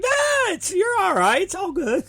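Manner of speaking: high, sing-song brush-off; mock reassurance. Promoter telling injured wrestler it's all good, mock reassurance. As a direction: wave it away in a silly high voice. Mock calm downplaying after mock panic.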